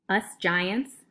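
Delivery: usual speaking voice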